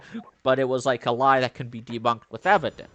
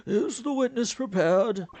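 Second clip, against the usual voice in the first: put-on old man voice; 10 semitones higher than usual. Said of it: "I cannot keep doing this old man voice".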